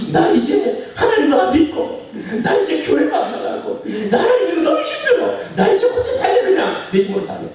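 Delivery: put-on high, half-laughing complaint of a hurt girl; whiny grumbling daughter. Daughter on the phone complaining to her father, whiny and high. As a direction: grumble to dad like a sulking daughter. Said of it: "투덜투덜 아빠한테 울었잖아요".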